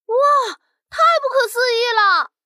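high wow of disbelief; amazed exclaim. Acted. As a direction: cry out in wonder. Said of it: "彤彤发出一阵惊呼".

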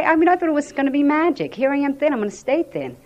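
ordinary talk

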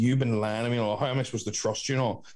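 usual speaking voice

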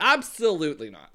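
loud, sassy "Absolutely not" with a lisped S; campy "Absolutely not" with sass; sassy lisped catchphrase. Shown as a demonstration. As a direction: snap "Absolutely not" with a lisp. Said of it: "a little bit of a lisp on the S".

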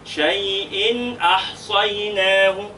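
شيء أحصيناه drawn out slowly with clear nunation; slow careful recitation; slow tajweed recitation. Shown as a demonstration. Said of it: "تحتها تنوين بالكسر".

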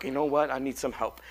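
normal talking voice

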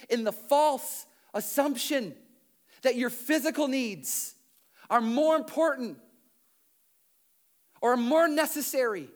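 loud, driving pulpit preaching; preaching at full force. Acted. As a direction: preach it hard to the whole room.

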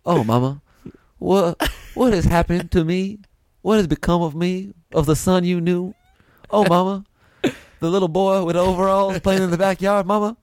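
put-on a dying boy calling for his mother, half-joking; hammed-up mock lament to mama; Southerner dying at war. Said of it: "a Southerner dying at war".

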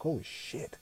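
breathless whisper; hushed stunned whisper. Retold or imitated. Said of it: "I was actually quiet, very silent".

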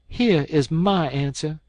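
high, resolute heroine; defiant young woman. A narrator's reading. Mary Jane defies the doctor.

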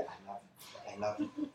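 soft, barely voiced I love you; murmured to himself. Preacher whispering love to himself while scolded, soft. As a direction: say it quietly to yourself.

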